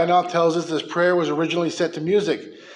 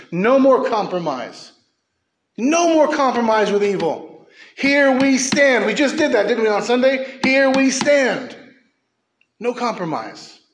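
shouted refrain; 7 semitones higher, 5 dB louder than usual. Real, not acted. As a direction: thunder here we stand like a battle cry. Climactic preaching refrain.

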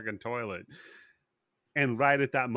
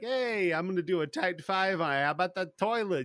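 high-pitched sing-out heckle, building louder; mock taunting; 7 semitones higher, 5 dB louder than usual. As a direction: needle him like a cocky heckler, stretching the last jab. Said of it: "he just starts taunting him".

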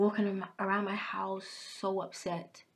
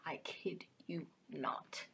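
slow unvoiced whisper; whispered aside; 3 semitones lower, 11 dB quieter, slower than usual. Real, not acted. Telling viewers she nearly went to a psych ward, whispering. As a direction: whisper it like a secret confession.

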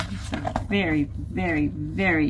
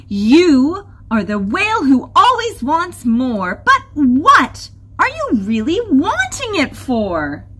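raised, loud challenge; forceful confrontation; 9 semitones higher, 7 dB louder than usual. A narrator's reading. Crystal challenges the whale about his wanting, loudly confronting him. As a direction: demand an answer with heavy emphasis.

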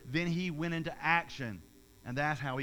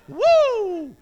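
much higher, loud wrestler's whoop; Ric Flair woo; 17 dB louder than usual. Retold or imitated. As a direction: howl it out like a strutting wrestling showman. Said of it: "or Ric Flair".

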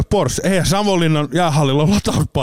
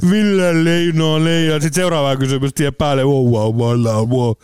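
slow name call repeating as it echoes away; echoing announcer. Retold or imitated. Announcer's voice circling the hall, echoing repeats. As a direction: let the name bounce off the arena walls. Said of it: "se ääni lähtee kiertää".

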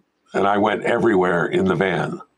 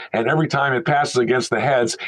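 usual speaking voice

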